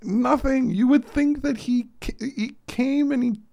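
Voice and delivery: high exasperated laughing rant; incredulous. Real, not acted. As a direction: rant in amazed disbelief.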